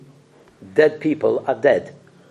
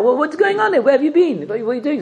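shrill scolding wife; 11 semitones higher than usual. Retold or imitated. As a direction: pitch way up as the angry wife. Wife scolding husband again.

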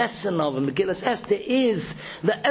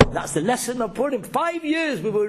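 normal talking voice

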